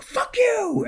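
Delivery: retelling Janine's retort in a sudden very high squeak; a hammed-up, squeaky dismissal; mock angry retort. Janine brushing off the co-star, in a mock squeaky snap. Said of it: "She's like".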